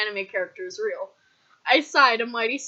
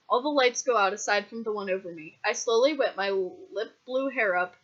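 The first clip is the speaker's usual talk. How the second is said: ordinary delivery, nothing marked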